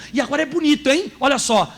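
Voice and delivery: ordinary talk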